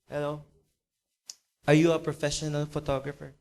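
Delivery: low, soft, hesitant phone greeting; very sad, hopeless. Acted. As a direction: ask cautiously, expecting little. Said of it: "malungkot na malungkot, dahan-dahan, without much expectation".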